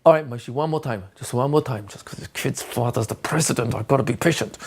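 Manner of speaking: mock weary patience. Shown as a demonstration. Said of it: "I'm being slightly sarcastic".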